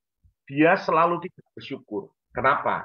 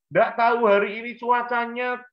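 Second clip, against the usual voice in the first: put-on much higher, a young son's voice; voiced child; 10 semitones higher than usual. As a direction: speak up high like a sulky child. Child answers mother in story.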